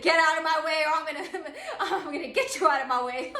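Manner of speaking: loud, laughing mock threat; beast roar. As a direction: play a beast charging through, half laughing.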